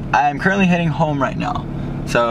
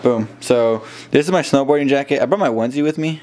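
usual speaking voice

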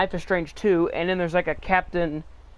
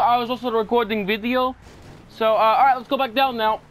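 put-on Russian accent, pitched higher, laughing through it; 4 semitones higher, 3 dB louder than usual. Explaining the detour to his passenger in a Russian accent. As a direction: play a cheeky Russian driver. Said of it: "back to Russian accent".

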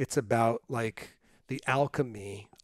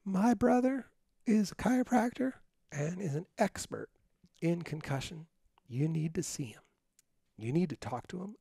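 higher, then softer and firm; imitating Kathy; 6 semitones higher, 5 dB quieter than usual. S0 retelling Kathy's instructions, lightly voiced and higher. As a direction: lay out orders like a no-nonsense older sister. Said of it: "That's my sister".

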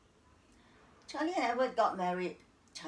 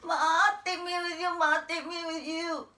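crying plea; 7 semitones higher, 6 dB louder than usual. Acted. Abandoned girl begging her mother to take her, crying out. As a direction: cry out desperately after a parent. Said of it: "I cried".

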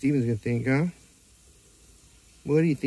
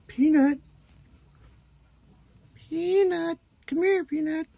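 high sing-song dog call; high-pitched calling Peanut; slower than usual. Real, not acted.

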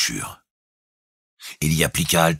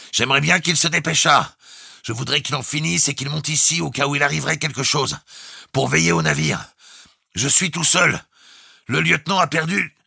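high, raised voice on a held pitch; calling out; 12 semitones higher, 3 dB louder than usual. A narrator's reading. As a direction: call down the tube for help. Captain calls down, wanting help. (appel insistant à voix haute)

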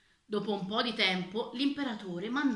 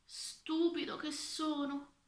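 slow, higher-pitched dismay; self-reproach; 7 semitones higher, 3 dB quieter, slower than usual. A narrator's reading. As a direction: chide yourself slowly. Official berates himself in thought.

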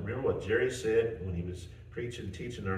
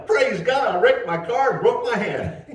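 high, loud, laughing rejoicing; joyful praise; 13 semitones higher, 12 dB louder than usual. Retold or imitated. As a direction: burst out in happy praise, laughing through it. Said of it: "do just like [name]".

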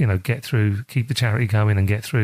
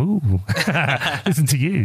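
laughing mock "ooh", a bit higher; playful ribbing, pitched up with a laugh; friends' teasing; 4 semitones higher than usual. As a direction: tease someone lightly about their accent. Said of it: "their friends will say to them".

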